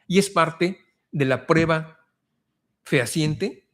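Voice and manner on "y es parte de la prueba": ordinary delivery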